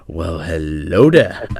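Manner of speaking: low, slow, teasing catchphrase greeting. Retold or imitated. Imitating a friend about to snipe him, low sly greeting. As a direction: drop the pitch and draw it out. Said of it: "he'd go".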